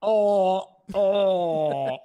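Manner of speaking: a hint of a dusty mummy rasp. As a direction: groan it like a mummy. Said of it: "Let's hear it".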